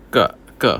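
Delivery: 个 said with a falling fourth tone. Shown as a demonstration. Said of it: "fourth tone".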